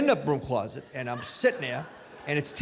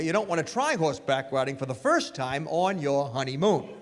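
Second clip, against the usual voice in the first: usual speaking voice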